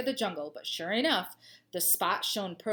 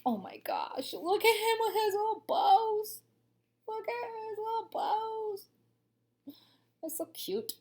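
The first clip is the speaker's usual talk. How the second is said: cooing; 13 semitones higher, slower than usual. Real, not acted. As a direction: gush over the little bows, stretching words. Cooing over the little bows.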